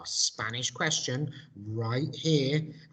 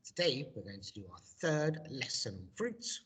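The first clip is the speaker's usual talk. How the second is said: ordinary talk, nothing marked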